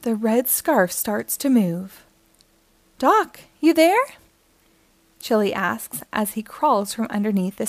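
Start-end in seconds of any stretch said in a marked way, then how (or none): 3.0-4.1 raised, slow questioning call. Acted. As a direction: call out higher and louder, unsure who is there. Toy calls out for Doc.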